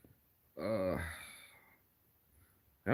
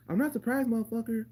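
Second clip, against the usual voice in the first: much higher, squeaky mock of her line; high mocking Pena; 12 semitones higher than usual. Streamer mocking how Pena said it, in a much higher voice. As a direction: mimic her high-pitched delivery. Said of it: "I just don't like how she said it".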